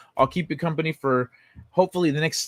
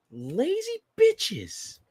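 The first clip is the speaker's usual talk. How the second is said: mock contempt; 12 semitones higher, much slower than usual. Real, not acted. Insult at closed manufacturer.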